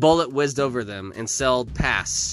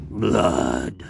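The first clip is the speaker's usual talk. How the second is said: lower-pitched monster moan; moaning giant; 5 semitones lower, 3 dB louder than usual. A narrator's reading. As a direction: moan it low and hungrily. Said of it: "the giant moaned again".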